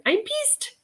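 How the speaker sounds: put-on high squeaky toy voice with an accent; stuffed animal voice. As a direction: pitch it way up like a kid's talking plush. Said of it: "stuffed animals talks with an accent".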